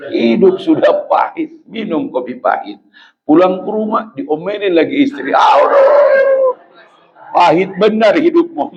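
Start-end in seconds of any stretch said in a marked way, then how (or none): 5.3-6.6 high shouted aduh of despair; hammed-up wail of aduh; mock anguished cry. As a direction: cry out as if life hurts. Groan at bitter life.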